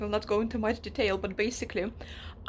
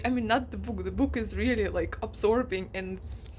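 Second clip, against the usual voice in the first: ordinary talk, nothing marked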